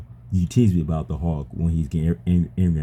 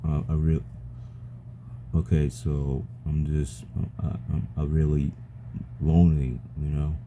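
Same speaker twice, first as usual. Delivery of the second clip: barely getting the words out; quiet admission; 2 semitones lower, 6 dB quieter than usual. Real, not acted. Admitting loneliness after the complaints.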